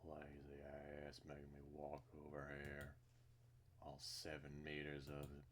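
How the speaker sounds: low, soft mutter; grumbling. Acted. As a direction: grumble it under your breath. Grumbling character line.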